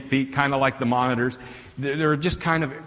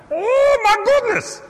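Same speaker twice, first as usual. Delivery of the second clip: very high, loud panicked yelp; panicked hotel worker; 8 dB louder than usual. Retold or imitated. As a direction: squeal in alarm as a shocked little hotel worker. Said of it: "little itty-bitty dude from India".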